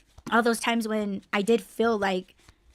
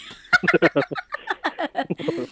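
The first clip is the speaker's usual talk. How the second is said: real laughter; 5 semitones higher than usual. Real, not acted. Laughs after teasing S1.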